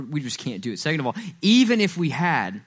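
usual speaking voice

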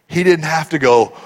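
ordinary talk

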